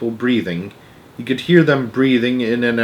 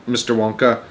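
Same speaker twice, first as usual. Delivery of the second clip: ordinary delivery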